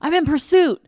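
loud, high self-important guard impression; hammed-up guard calling it in; mock security guard. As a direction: play a security guard radioing like police. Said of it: "And they're like".

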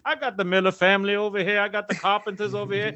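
high, punchy game show host patter; Steve Harvey. Acted. Husband filling in for a sick game show host, hammed-up host impression. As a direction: play a TV host hyping the contestant families. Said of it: "fill in for Steve Harvey".

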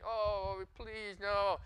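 mock a screechy, irritating complainer; screechy whine. Shown as a demonstration. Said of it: "that really screechy, irritating".

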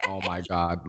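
ordinary talk

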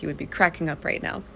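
ordinary talk, nothing marked

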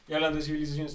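ordinary talk

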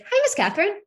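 put-on high-pitched little-kid hello; sweet child greeting teacher. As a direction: imitate a small boy suddenly being nice. Voices son sweetly greeting teacher.

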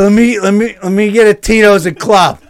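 loud, high hammered bar order; mock drunken shout. Hammered customer ordering at the bar, mock drunken shouting. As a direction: bellow it like a comic drunk. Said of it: "I was fucking hammered".

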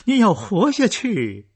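high loud desperate plea; anguished cry. A narrator's reading. Daughter crying to her dying father, anguished and loud. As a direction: cry out to keep him alive. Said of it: "摸着父亲冰凉的手".

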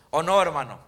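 confident tag seeking a yes; affirming tag. Shown as a demonstration. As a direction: press for agreement with a falling no. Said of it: "es como cuando decimos".

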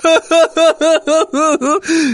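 high-pitched fake giggle; high giggle burst. Scammer bursts out laughing.

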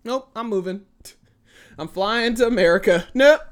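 mock panic. Hammed-up panic at Australian spiders, fleeing. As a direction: play it as comic alarm and flight. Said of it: "I was like".

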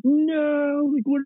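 squeaky, disbelieving imitation of an investor; mock baffled shareholder. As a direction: voice leaps way up on the first word. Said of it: "he's like".

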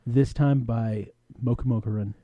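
usual speaking voice

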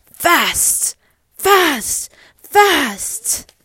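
loud, high bursts, each one punched; excited exclamations. Acted. Parent reads racing dog cars to child, excited shouts. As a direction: shout each word like cars zooming past.

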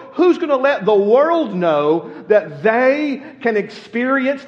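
high, loud, urgent preacher's question; impassioned altar call. Acted. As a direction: rouse them to go out.

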